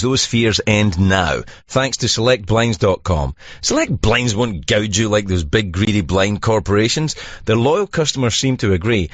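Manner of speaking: low, steady sponsor read. Acted. Host reading a blinds ad to listeners, steady. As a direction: sell it like a radio spot.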